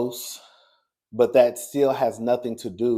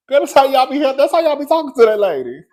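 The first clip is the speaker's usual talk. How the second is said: ordinary talk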